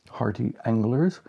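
usual speaking voice

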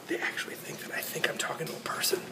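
hushed, voiceless aside; secretive whisper. Real, not acted. Vlogger confides about strangers in the elevator, secretive whisper. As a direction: whisper it privately to the camera.

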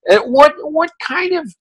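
imitating an indignant grumble, pitched high; exasperated Tom. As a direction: whine like a fed-up editor, way up high. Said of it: "he goes".

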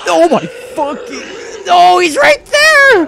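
panicked high slow scream; terrified shriek while fleeing; being chased. Real, not acted. Being chased, pursuer reappears.

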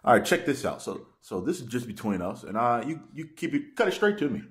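ordinary talk

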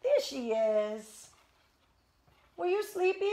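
high sing-song pet voice. Real, not acted. Owner coaxing her puppy up to play in high baby talk. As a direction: coo at the puppy to coax her.

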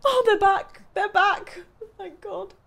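squeaky hysterical relief; laugh-cry in a very high voice; high relieved squeal. Real, not acted.

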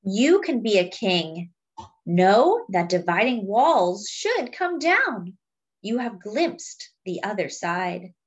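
slow, weighty refrain then lively lines; storybook reading with a slow refrain; reading to children. Acted. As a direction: read the book aloud to the class. Reading picture book to children.

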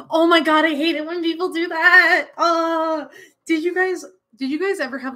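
disgusted outburst. Real, not acted. Disgust rant about thumb licking.

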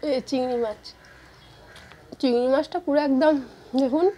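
talking with food in mouth; words between bites; chewing while talking. Real, not acted. Seen 0: bites food near 0.9, lips working while sorting plate.

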